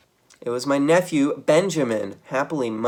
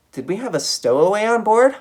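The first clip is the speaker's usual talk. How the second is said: higher and louder, alarmed question; startled wonder; 10 semitones higher, 8 dB louder than usual. A narrator's reading. Geronimo wondering about a stowaway, surprised and high. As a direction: gasp at the idea of a stowaway.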